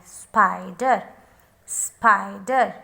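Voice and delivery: spider low, with a drawn-out spy; long I. Shown as a demonstration. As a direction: stretch the I in spider. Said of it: "this I is long I".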